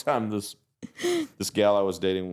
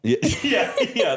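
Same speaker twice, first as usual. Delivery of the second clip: high and a bit louder, laughing through yeah; 14 semitones higher, 4 dB louder than usual. Real, not acted. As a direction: laugh out the yeahs. Laughs at the Lubbock tease.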